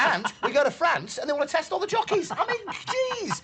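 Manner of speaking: comic exasperation. Real, not acted. Pundit ranting about jockey testing in France, comic exasperation. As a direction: build the outrage to a long, high "jeez". Comic rant peaks amid laughter.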